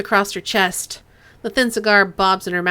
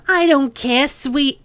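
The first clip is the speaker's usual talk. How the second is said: put-on sing-song, louder, the wrong accent creeping in; Chang's line drifting into the cop's accent; slipping into Duncan's accent; 5 semitones higher, 4 dB louder, slower than usual. Boss Chang brushing off the cop, slipped into his accent. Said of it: "why is she picking up his accent".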